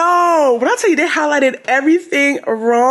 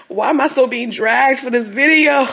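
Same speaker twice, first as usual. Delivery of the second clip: normal talking voice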